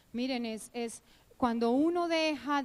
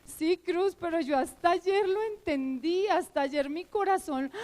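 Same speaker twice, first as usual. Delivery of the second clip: higher-pitched, excited retold protest; earnest insistence; 5 semitones higher, 4 dB louder than usual. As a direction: insist that it only just sank in. Retells earnest reply to friend.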